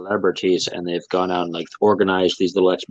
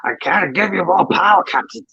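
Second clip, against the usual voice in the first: bad Scottish engineer accent, pitched high; overdone Scottish accent begging the captain; terrible Scottish accent; 10 semitones higher than usual. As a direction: ham up a Scottish ship engineer. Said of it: "a terrible Scottish accent".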